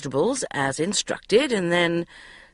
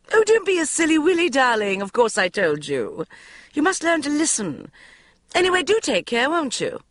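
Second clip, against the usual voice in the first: high, loud, fast and dismissive; mother teasing her daughter; flighty mother; 10 semitones higher, 4 dB louder than usual. A narrator's reading. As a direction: chide her lightly like a mum. Mother voiced, scolding lightly.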